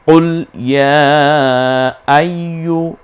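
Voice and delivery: slow, drawn-out chanted recitation; Quran recitation. Shown as a demonstration. Teacher recites the verse slowly for students. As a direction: stretch each vowel like tilawat.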